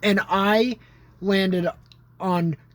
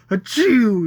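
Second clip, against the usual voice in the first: mock sneeze; 4 semitones higher, 7 dB louder than usual. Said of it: "he went".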